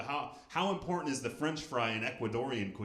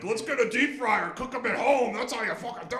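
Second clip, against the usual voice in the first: mock family voice; 13 semitones higher, 11 dB louder than usual. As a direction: belt it like a proud trashy relative.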